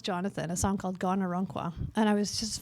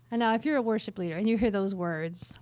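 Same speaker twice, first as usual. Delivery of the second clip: ordinary talk, nothing marked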